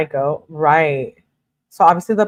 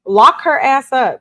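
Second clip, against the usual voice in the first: higher, louder, slow and emphatic; mock juror verdict; 7 semitones higher, 4 dB louder, slower than usual. Imagined juror calling for her conviction, mock emphatic. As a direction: demand it like a fed-up juror. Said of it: "we would have been like".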